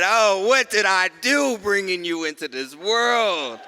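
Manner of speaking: loud, high cry of despair to the heavens; father crying out over his newborn; anguished outcry. Retold or imitated. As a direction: wail it out at the newborn's rough start. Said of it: "I said".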